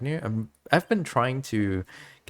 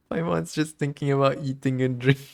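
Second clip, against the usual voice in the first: laughing; 6 semitones higher, 4 dB louder than usual. Real, not acted. Laughing at Paimon's appetite.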